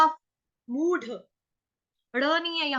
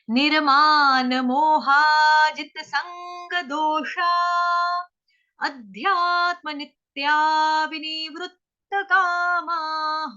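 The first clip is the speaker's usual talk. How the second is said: slow Sanskrit recitation; 2 semitones higher, slower than usual. Shown as a demonstration. Teacher recites the Gita verse for students, slow and measured. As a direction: chant each word slowly and clearly. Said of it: "पहली दो line सुनेंगे".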